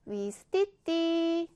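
위스티띠 very drawn out. Shown as a demonstration. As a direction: stretch out the last vowel of 위스티띠 for the camera. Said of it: "사진 찍을 때 하는 말 뭐예요?". (끝을 길게 늘인 "위스티띠")

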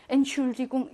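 "엔슐디궁" with the en sounded before schul; full form with prefix. Shown as a demonstration. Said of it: "이렇게 하는 경우가 많이 없어요".

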